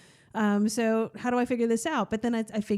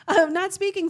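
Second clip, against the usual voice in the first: laughing; 10 semitones higher, 7 dB louder than usual. Real, not acted. Still laughing.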